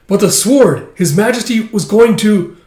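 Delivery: high, loud alarmed outburst; shocked cry. Acted. Man realizing the sword failed, alarmed loud cry. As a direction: blurt it out in sudden alarm.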